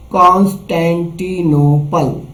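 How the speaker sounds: Constantinople stretched slowly, piece by piece; sounded out slowly. Shown as a demonstration. Teacher breaking a long word for children, slowly. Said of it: "टुकड़े करना है".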